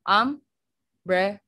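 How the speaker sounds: "umbrella" in three separate slow beats; syllable by syllable. Shown as a demonstration. Teacher counts out syllables for class, "umbrella" slowly broken apart. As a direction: split "umbrella" as um, bre, lla with pauses. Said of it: "There are three syllables".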